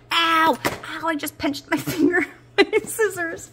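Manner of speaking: sharp high yelp of pain, half laughing; real pain. Real, not acted. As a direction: cry out at a pinched finger. Pinched finger with scissors.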